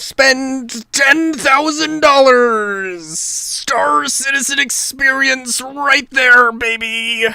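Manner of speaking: slow, loud, hammed-up pitch shout; mock salesman shout. Streamer parodying the studio as a salesman, slow hammed-up shout. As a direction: bellow it like a cheesy advert.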